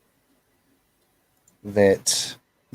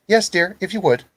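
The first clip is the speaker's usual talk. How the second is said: high sweet reply; 10 semitones higher than usual. Acted. Daughter answers from alley.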